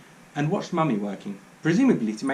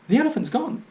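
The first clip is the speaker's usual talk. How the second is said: put-on excited child; 12 semitones higher, 4 dB louder than usual. A narrator's reading.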